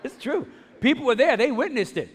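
high, loud comic insistence; mock-indignant, high-pitched self-defence; amused protest. Real, not acted. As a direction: insist over the laughter, pitch way up. Protesting over the laughter, amused.

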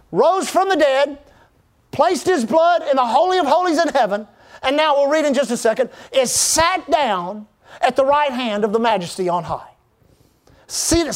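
driving sermon delivery with punched key words; emphatic preaching. Real, not acted. Preacher tells congregation of their new identity, emphatic preaching. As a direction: preach it in rising and falling phrases.

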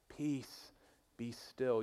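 "Peace, be still" murmured softly and serenely; a lightly voiced gentle teacher calming the sea; soft serene Jesus. Preacher asking if listeners hear Jesus this way, soft. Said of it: "is that how you hear Jesus speak?".

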